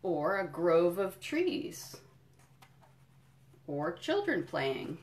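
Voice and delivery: slow, lilting picture-book read; reading to children. Acted. Museum educator reading a picture book to kids, storytelling. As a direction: savour each item for little listeners.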